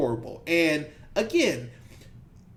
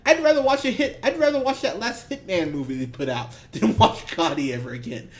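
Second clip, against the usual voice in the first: higher, loud scoffing through laughter; scoffing at the studio's claim, laughing; 8 semitones higher, 3 dB louder than usual. Retold or imitated. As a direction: laugh it off in disbelief. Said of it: "I went".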